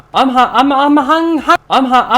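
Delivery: high, loud stammering whine; hungry whine. Acted. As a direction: sputter out how hungry you are. A starving voice stammers.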